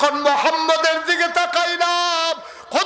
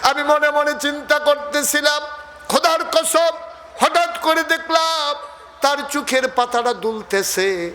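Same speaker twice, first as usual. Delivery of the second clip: awed; 9 semitones higher than usual. Acted. Halima's wonder, raised pitch.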